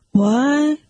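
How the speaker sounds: sudden high startled question. Acted. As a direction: jolt awake and blurt it.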